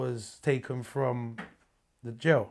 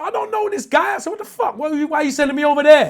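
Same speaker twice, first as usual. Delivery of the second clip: much higher and louder, frantic; panicked protest; 12 semitones higher, 11 dB louder than usual. Retold or imitated. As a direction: plead with the warder in sudden fear. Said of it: "I'm really panicking at this point".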